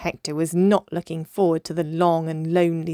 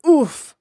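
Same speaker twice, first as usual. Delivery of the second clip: loud, high winded "oof"; 7 semitones higher, 10 dB louder than usual. A narrator's reading. Dolphin hit in the belly by his cousin, winded oof. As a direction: grunt as if jabbed in the belly.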